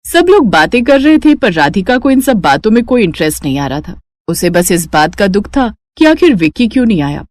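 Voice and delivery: usual speaking voice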